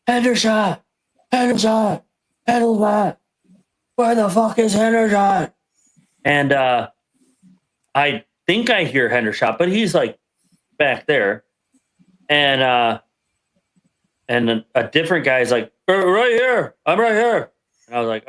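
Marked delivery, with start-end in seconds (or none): 0.1-5.5 drill sergeant yell. Retold or imitated. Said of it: "drill sergeant yells out". 15.9-17.5 called out answer. Retold or imitated. Recruit answering the drill sergeant.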